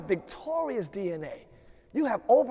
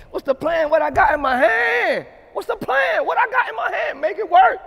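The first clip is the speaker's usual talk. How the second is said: loud, high, shouted chant of questions; preaching at full force; 7 semitones higher, 10 dB louder than usual. Acted. Pastor rallying the chat to boss up, shouting preaching. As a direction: hammer the crowd with each question.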